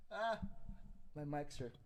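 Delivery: normal talking voice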